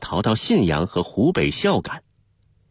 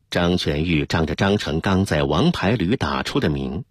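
ordinary delivery, nothing marked

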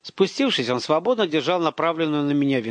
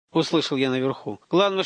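usual speaking voice

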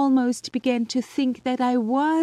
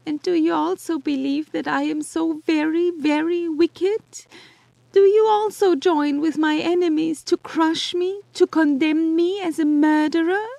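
sobbing plea. Acted. Justine begging Elizabeth not to join her enemies, sobbing. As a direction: plead through sobs, voice cracking upward. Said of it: "Her voice was suffocated with sobs".

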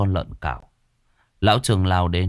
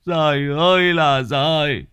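put-on bawling like a child; 9 semitones higher, 6 dB louder, slower than usual. Husband blaming himself at his wife's feet, wailing loudly. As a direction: wail building to high, loud, drawn-out cries. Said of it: "Lão òa khóc như đứa trẻ".

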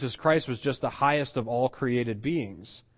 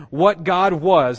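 loud, slow WHAT GOD WAS, pitched high; emphatic stress; 5 semitones higher, 9 dB louder, slower than usual. Retold or imitated. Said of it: "He's stressing the essence and quality".

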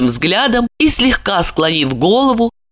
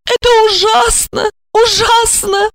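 high female voice; bashful lament. A narrator's reading. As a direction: cut him off in distress. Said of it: "Стыдливо потупилась Ольга".

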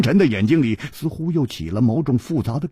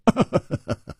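higher staccato chuckle; acted laugh; 8 semitones higher than usual. A narrator's reading. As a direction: laugh lightly in quick ha-ha's. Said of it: "回头向黑珍珠一笑说". (一串轻快的笑声)

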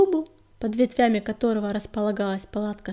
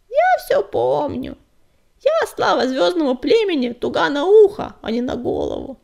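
put-on grumbling old she-cat; 4 semitones higher, 8 dB louder than usual. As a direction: grumble back, offended and proud. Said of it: "заворчала Кривуля".

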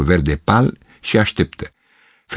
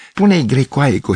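mild call; 7 semitones higher than usual. A narrator's reading. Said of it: "strigă țiganca".

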